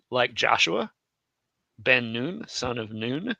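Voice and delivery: ordinary talk, nothing marked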